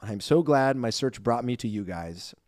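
usual speaking voice

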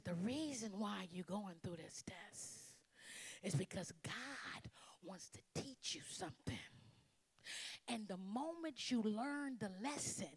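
slow, soft, whispered counsel; gentle fatherly counsel. Retold or imitated. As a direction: tell her tenderly, father to daughter. Dad's gentle wisdom.

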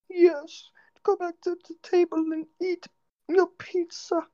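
very high squeaky falsetto; imitated daughter, squeaky and high-pitched; little daughter's voice. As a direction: put on a tiny girl's voice. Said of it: "they have a daughter".

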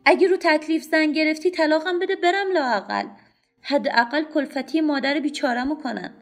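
loud, high, bitter shouting; a woman on the train screaming back at her unseen husband; angry outburst. A narrator's reading. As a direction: hurl it back at the husband in fury. Woman pounding the window, arguing.

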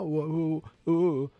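mock alarm. Mocking fan panic.

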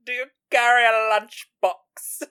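high, loud, falling quip; mock snooty quip. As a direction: punch carry high and loud. Repeating the favourite line.

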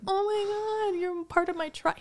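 high, excited squeal of delight; starstruck gush. Retold or imitated. To [name] about his freckles, a high starstruck gush. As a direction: gush at him like a starstruck fan.

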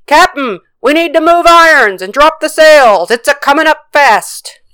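high, loud urgent sailor's yell. A narrator's reading. First mate warns captain of the coming storm, yelling. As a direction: yell an urgent warning over the wind. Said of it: "and the first mate yelled".

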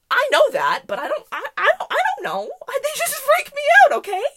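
loud, high, exasperated outburst; flustered protest. Retold or imitated. Butterfly-hater answering those who say they don't bite, flustered. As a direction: protest helplessly, pitch climbing into a squeal. Flustered retort to everyone's reassurance.

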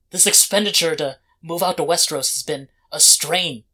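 loud, emphatic scolding with pauses; stern head of household. Acted. Head of household demanding help securing the family, stern. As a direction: lay down the law, pressing each point.

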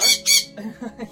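quick high chuckle; short laugh. Real, not acted. Laughs at own joke.

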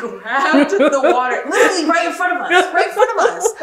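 high, loud laughing outrage; cracking up while telling it; laughing in disbelief. Real, not acted. Laughing loud and high telling her friend the story.

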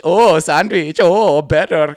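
loud mock-accented coworker; hammed-up echo, stretching the "Oh"; hacky Hassan. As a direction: repeat the order in a put-on accent. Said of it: "kind of a hacky Hassan".

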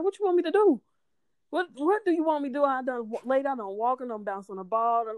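high-pitched, worked-up pleading; exasperated protest. Retold or imitated. Overdue mother retelling her protest to the doctor, exasperated. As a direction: protest as if out of options.

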